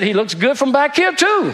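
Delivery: wife teasing husband about Satan, coy and high; mock flirty wife. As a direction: deliver the punchline coyly, rising high. Punchline of the joke.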